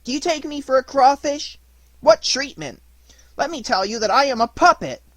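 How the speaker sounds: high, loud, indignant puppet voice; mortified little puppet snapping back; angry puppet. Acted. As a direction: protest hotly as an insulted puppet. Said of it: "said in an angry voice".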